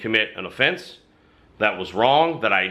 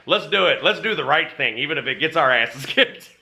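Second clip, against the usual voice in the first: higher-pitched eager rallying cry; game for a fight, laughing at the end; fired up; 5 semitones higher than usual. Retold or imitated. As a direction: retell a buddy getting pumped up. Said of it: "got more and more fired up".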